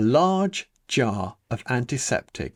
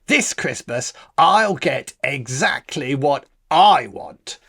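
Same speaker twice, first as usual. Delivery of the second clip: put-on louder, eager greedy boy; a boy vowing to get everything; 3 semitones higher, 5 dB louder than usual. Greedy Bradley's vow.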